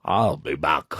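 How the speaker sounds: deliberately lame Terminator line, low and heavy; Arnold Schwarzenegger. Acted. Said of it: "Arnold Schwarzenegger in Terminator".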